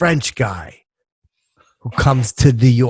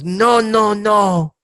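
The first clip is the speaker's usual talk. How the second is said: higher-pitched, lightly voiced French tourist; French guy refusing; 6 semitones higher, much slower than usual. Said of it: "the French guy goes".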